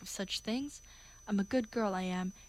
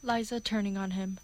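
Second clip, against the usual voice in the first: ordinary delivery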